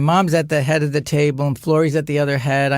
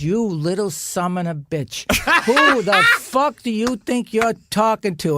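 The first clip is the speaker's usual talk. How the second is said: mock furious mother; 5 semitones higher than usual. Mother turns on son.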